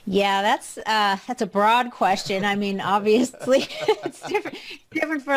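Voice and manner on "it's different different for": laughing. Real, not acted. Guest laughs mid-answer.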